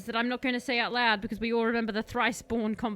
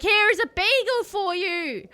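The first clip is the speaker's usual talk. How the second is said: high, loud, frantic cry; desperate scream; 9 semitones higher, 7 dB louder than usual. Acted. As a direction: shove the gift at him, panicking. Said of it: "He screams desperately".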